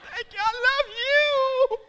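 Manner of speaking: high, loud, tearful wail of thanks; hammed-up squealing devotion; gushing gratitude. Laboring woman thanking her doctor, gushing gratitude.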